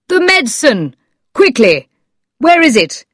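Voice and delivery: high, urgent bursts; urgent cry. A narrator's reading. Doctor demanding medicine for a sick man, crying out. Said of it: "I cried".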